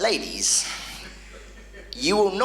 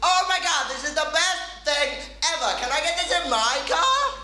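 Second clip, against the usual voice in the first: high, gushing delight; amazed friend cooing over the car; excited passenger; 12 semitones higher than usual. Retold or imitated. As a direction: squeal it like a thrilled passenger. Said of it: "and she goes".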